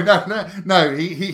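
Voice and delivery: usual speaking voice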